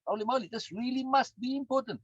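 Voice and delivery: high, loud amazement at the discovery; mock-awed brain realising something matters; amazed brain. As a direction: gasp it out like a sudden revelation. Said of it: "Your brain says".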